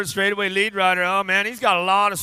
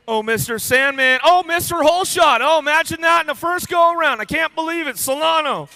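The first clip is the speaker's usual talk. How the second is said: rising, louder hype call of a surprise win; excited; 8 semitones higher, 5 dB louder than usual. Real, not acted. Announcer hyping a rider's big holeshot, thrilled. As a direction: pour on the disbelief and hype.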